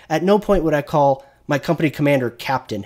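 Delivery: usual speaking voice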